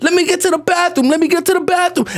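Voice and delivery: put-on high, urgent child's plea; pleading first-grader. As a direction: beg to be let through. Acted his child self pleading.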